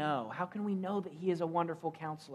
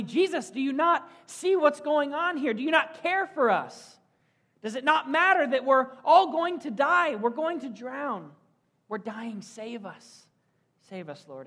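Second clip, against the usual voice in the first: panicked cry for help; 8 semitones higher, 6 dB louder than usual. Acted. Disciples beg Jesus to save them from drowning, high and panicked. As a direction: cry out to a sleeping Jesus as the boat sinks. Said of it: "the disciples cry out".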